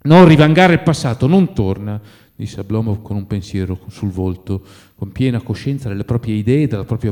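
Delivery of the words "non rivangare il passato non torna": raised, higher voice; loud and insistent; agitated protest. A narrator's reading. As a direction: push back against the reproach. Quarrel between the two friends.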